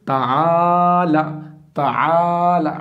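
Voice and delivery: ताला drawn out very slowly, each vowel held; clear maroof recitation. Shown as a demonstration. Teacher models correct reading of ताला, slowly drawn out. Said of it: "पढ़ना है मारूफ".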